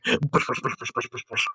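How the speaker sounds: whispered bush noises. Pretend. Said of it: "every time he moves, he's like".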